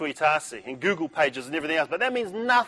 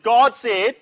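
triumphant; 6 semitones higher, 6 dB louder than usual. Retold or imitated. Punchline shouted to the teacher.

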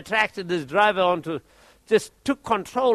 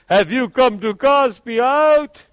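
demon voice; 2 semitones higher, 5 dB louder, slower than usual. Retold or imitated. Demon manifests through a man.

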